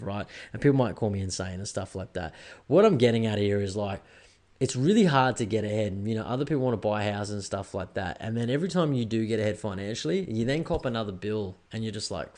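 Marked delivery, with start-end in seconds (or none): none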